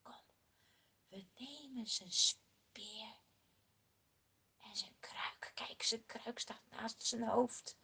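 hushed storyteller whisper; whispering the sneaky plan. Acted. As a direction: whisper so Saul won't wake. Sneaking past sleeping king.

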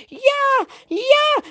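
high, loud mock-crying wail; tearful whining. A narrator's reading. Potifar's wife insists to the doubting slaves, tearfully wailing. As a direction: insist in a weepy screech. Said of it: "heel een huilerige stem".

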